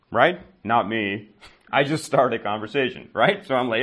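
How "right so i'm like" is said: ordinary delivery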